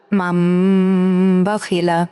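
"মাম" with a long hummed m before "বা খিলা"; ghunnah. Shown as a demonstration. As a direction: hold the m of "মাম", then release into "বা". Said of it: "এবং গুণনা করতে হবে". ("মাম"-এর ম গুন্নাসহ টেনে "বা খিলা")

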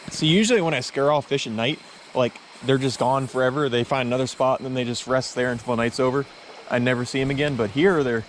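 ordinary delivery

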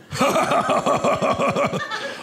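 wheezy breathy giggle; high wheezing stoner laugh; Seth Rogen laugh. Acted. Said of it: "Seth Rogen".

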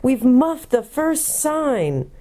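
mildly impatient, slowing down. A narrator's reading. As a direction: press the bad news on him. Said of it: "said Jill impatiently".

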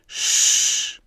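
loud, unvoiced shush; sharp hush for silence. A narrator's reading. General silencing questions about the project, shushing.

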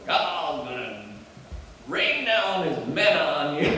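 slow mock Southern drawl, rising high on the last words; hammed-up Southern preacher drawl. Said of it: "they say in big Southern drawl".

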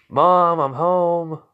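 calling out. Acted. Jeff getting home to his mom, calling out high.

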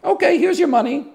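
high squeaky-pitched caricature of a compliant clerk; mock betting lady. Betting window lady giving in to the trick, high mock voice. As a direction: hand it over in a put-on high voice. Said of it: "the lady's just like".

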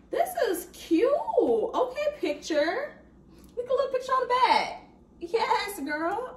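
high, gushing approval; excited hyping. Real, not acted. Reader hyping an acquaintance's book picture, excited and high. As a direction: cheer her on in a high voice.

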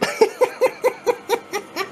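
high chuckle in quick pulses; real laugh. Real, not acted. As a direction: burst out laughing in surprise. Laughs at coins suddenly moving.

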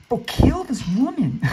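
loud, drawn-out high shout; loud high yell, slow. Real, not acted. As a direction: yell it slowly, fired up at the screen. Yelling at game.

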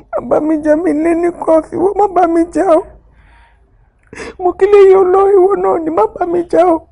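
begging her husband through tears not to fight; tearful pleading. Acted. Wife begs husband not to quarrel, crying loudly.

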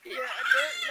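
loud laughing yell; excited laughing shout. Real, not acted. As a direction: blurt it out laughing.

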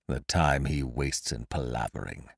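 low, soft grumble under the breath; muttering. A narrator's reading. Waiting master complains about his servant, muttering low. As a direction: grumble quietly to yourself. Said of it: "muttered Kesselbach".

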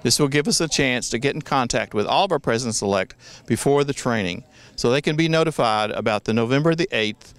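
ordinary talk, nothing marked

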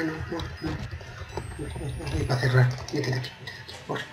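urgent whisper, breathless. Real, not acted. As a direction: hiss it so nobody hears. Hiding, guard approaching.

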